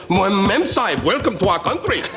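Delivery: a jokey imitation of Indian English; mock Indian accent. Comedian mocking London hotel staff in a hammed-up Indian accent. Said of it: "they talk like that 'cause they're all from India".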